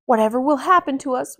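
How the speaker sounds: a touch of panic in a villager's voice; worried woman's voice, a bit fast; mild fretful woman. Acted. Frightened townswoman voiced.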